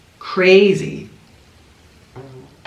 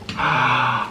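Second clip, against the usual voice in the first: long, airy gasp; 4 semitones lower, 4 dB quieter than usual. Real, not acted. Host marvels at the deep fry, amazed gasp. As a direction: draw in breath in amazement.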